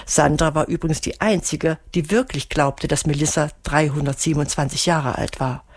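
ordinary delivery

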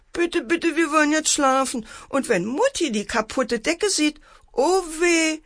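high, pleading little-sister voice; mock sobbing plea. A narrator's reading. Little sister begs brother to go to sleep, high tearful pleading. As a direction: plead high-pitched, as if close to tears. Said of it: "schluchzte Antje".

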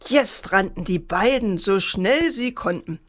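plain reading voice

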